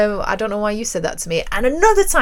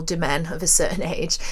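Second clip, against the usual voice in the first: ordinary talk, nothing marked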